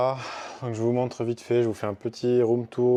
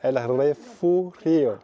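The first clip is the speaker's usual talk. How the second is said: "El Refugio" again, very slow, in a Spanish accent and a little louder; careful second go at "El Refugio" in Spanish; retrying "El Refugio" with a Spanish accent after asking how it's said; 4 semitones higher, 6 dB louder, much slower than usual. Shown as a demonstration. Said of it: "Comment c'est dit".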